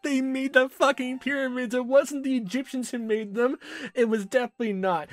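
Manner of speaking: high, loud, barely holding back laughs; reading through laughter. Real, not acted. Player reads the alien scrapbook aloud, giggling high and loud. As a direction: try to read it straight but crack up.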